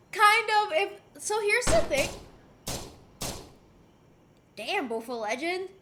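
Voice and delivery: usual speaking voice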